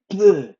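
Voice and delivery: loud, high mock spit at them; a rude blowing spit sound; a sudden loud pretend spit; spitting noise. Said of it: "that French thing where you spit at 'em".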